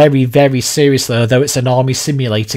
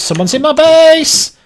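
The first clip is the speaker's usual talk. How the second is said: high, slow alarmed call; 12 semitones higher, slower than usual. Real, not acted. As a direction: raise the alarm to the team. Repeated louder, higher alarm.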